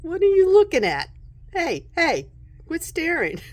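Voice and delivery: high, loud, affronted challenge; playful mock outrage at a starer; indignant card character. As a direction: call out an offended telling-off. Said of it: "she's like looking at the star like".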